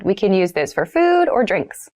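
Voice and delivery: usual speaking voice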